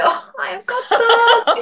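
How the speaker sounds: high, slow, laughing sigh of relief; retold relief. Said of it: "っていう". (笑いながら高く、ゆっくりと安堵する)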